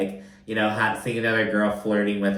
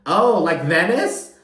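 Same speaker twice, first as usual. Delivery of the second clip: mimicking Lisa; 7 semitones higher, 5 dB louder, slower than usual. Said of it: "the first thing on Lisa's mind, out of her mouth is".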